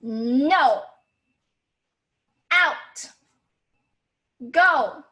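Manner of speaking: loud, sharp single-word commands; angry fourth tone. Shown as a demonstration. Teacher demonstrates fourth tone, angry loud commands. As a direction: order them out, word by word. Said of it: "You're angry".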